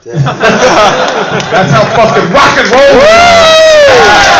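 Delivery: loud, high, drawn-out rocker yell; whooping shout. Real, not acted. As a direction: holler it through laughter, stretching the end. Cheers the broken chair.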